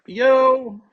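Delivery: high, loud little yo; excited yelp. Real, not acted. Hyped unboxing greeting, high loud yo. Excited shout after intro.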